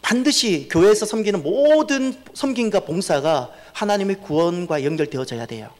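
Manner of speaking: ordinary talk, nothing marked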